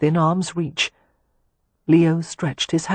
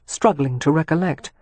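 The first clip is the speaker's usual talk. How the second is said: ordinary delivery, nothing marked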